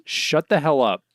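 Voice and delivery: half-earnest angry outburst; mock scolding. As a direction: imitate cutting someone off crossly. Retelling a character cutting off another.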